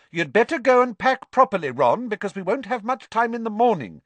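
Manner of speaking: loud, high motherly order; calling out. A narrator's reading. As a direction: call down a long table to your son. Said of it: "called down the table".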